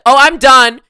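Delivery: high, loud blurt after silence; loud announcement. Retold or imitated. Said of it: "My voice was ready".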